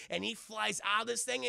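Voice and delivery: ordinary talk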